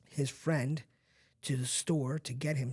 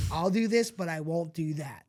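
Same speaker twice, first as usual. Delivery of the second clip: loud, hammed-up bravado; cocky teen promise; 3 semitones higher, 6 dB louder than usual. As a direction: belt out a kid's cocky bargain.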